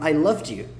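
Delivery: "loved you" with D linked to Y; past form. Shown as a demonstration. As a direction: sound the D in "loved" before "you". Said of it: "is different from".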